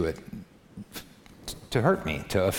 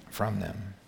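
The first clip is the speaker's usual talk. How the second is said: ordinary talk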